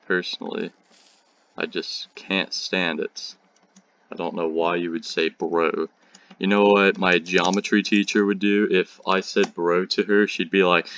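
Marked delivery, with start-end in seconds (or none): none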